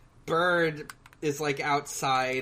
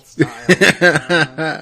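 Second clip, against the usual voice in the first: laughing; 12 dB louder than usual. Real, not acted. Laughing joke.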